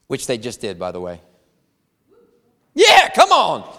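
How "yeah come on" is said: fired up. Real, not acted. Pastor firing up the congregation.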